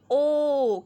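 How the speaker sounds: ओ held as a pure long o, not oo; clear long o. Shown as a demonstration. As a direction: say ओ round and open, never ऊ. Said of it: "ओ की ये क्या आवाज़ मैं निकाल रही हूँ?".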